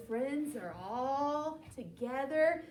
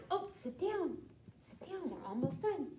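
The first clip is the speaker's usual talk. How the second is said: soft aside; 2 semitones higher, 7 dB quieter, faster than usual. Real, not acted. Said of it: "reader to fidgety child".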